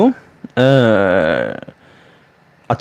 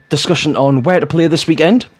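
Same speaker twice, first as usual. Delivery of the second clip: usual speaking voice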